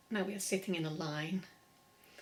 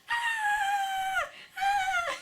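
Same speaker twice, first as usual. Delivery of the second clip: very high, loud playful scream; 7 dB louder than usual. Shown as a demonstration. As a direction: scream like you saw a crocodile. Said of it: "don't forget to scream".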